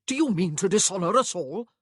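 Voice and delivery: loud, indignant challenge; voice rising. A narrator's reading. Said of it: "his voice rising".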